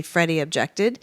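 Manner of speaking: ordinary delivery, nothing marked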